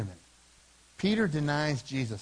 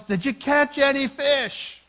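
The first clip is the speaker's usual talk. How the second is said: high, loud, drawn-out yell; 9 semitones higher, 7 dB louder, slower than usual. Acted. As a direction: call out slowly to men far offshore. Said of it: "the man yells".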